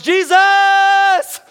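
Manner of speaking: high, maximum-volume drawn-out scream; all-out desperate yell. Acted. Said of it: "louder, harder than before".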